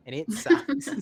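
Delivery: laughing. Real, not acted. Laughing complaint about time zones.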